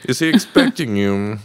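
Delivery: high, haughty gatekeeper; snooty guard voice. Pretend. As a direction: sniff at the visitor. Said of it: "And a snooty voice".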